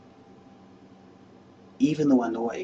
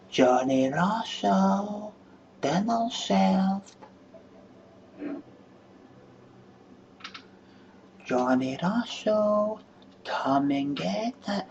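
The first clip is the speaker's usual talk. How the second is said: high, very slow sing-song call; woman calling her man in for dinner; woman calling out the window; 9 semitones higher, much slower than usual. Shown as a demonstration. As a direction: call him in for dinner, drawn out. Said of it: "Shouting out the window".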